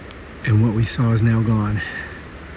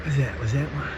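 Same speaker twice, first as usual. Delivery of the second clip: hushed; 17 dB quieter than usual. Real, not acted. Hushed while spotting something.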